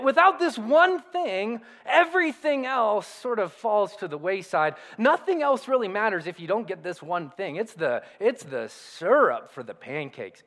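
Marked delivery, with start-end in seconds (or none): none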